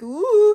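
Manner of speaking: high, loud teasing ooh; taunting high-pitched oohs; mock-menacing high hoot. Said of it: "move his finger and be like".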